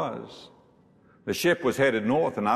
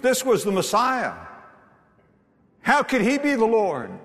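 full-force preaching; 6 semitones higher than usual. Acted. Preacher building to the congregation, fervent full-force preaching. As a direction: drive the congregation with rising fervour.

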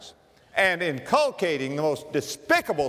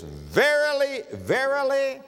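Verily, verily high, slow and drawn out; slow emphatic verily; 11 semitones higher, slower than usual. Shown as a demonstration. Preacher stretching Jesus' words for emphasis, high and slow. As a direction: lift Verily, verily up slowly. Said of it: "he is saying it for emphasis".

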